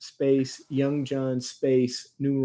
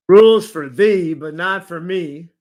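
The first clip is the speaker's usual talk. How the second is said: loud; 2 semitones higher, 9 dB louder than usual. Acted. Investigator's quoted taunt.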